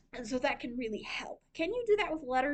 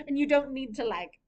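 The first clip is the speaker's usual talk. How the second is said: ordinary delivery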